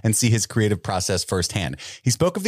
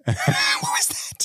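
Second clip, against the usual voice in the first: ordinary talk, nothing marked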